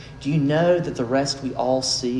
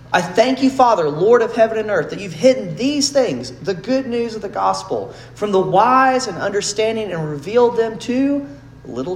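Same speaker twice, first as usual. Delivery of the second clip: higher, louder impassioned preaching; preaching at full force; 7 semitones higher, 5 dB louder than usual. Acted. Preacher voicing Jesus praying to the Father, higher and louder. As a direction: lift the prayer up with fervour.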